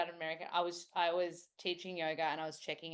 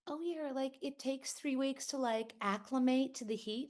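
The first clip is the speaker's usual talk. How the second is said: put-on higher-pitched American accent; imitated American teachers; 7 semitones higher than usual. Yoga teacher chatting about heat, high American accent. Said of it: "I always hear the teachers say".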